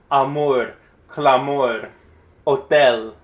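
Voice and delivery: amor, clamor, hotel each punched on the last syllable; loud stress on the end: a-MOR, cla-MOR, ho-TEL; final-syllable stress. Shown as a demonstration. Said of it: "accent is assumed to go at the very end".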